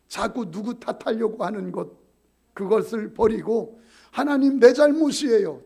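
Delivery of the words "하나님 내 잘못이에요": louder, higher confession leading the crowd; "하나님, 내 잘못이에요" proclaimed loud. Shown as a demonstration. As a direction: call it out for them to repeat. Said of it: "따라서 합시다".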